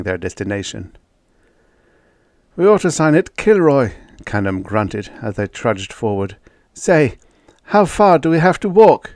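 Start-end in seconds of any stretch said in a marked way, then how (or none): 2.6-3.9 joking. Acted. Character joking loudly. 6.7-9.1 dismayed. Acted. Character asks in dismay.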